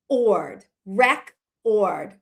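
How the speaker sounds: rec and ord as separate chunks, paused between; syllables sounded out. Shown as a demonstration. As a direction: break it up slowly: rec... ord. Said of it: "and then saying".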